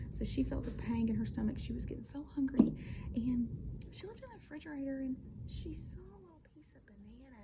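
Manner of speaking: ordinary delivery, nothing marked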